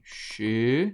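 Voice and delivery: "chegarão" in three detached syllables; long pauses inside "chegarão", each syllable stretched. Shown as a demonstration. Separa a palavra em sílabas. ("chegarão" bem separado, sílaba por sílaba)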